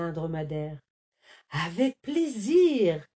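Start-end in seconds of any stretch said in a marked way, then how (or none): 1.5-3.1 delighted. A narrator's reading. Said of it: "se réjouit Lou".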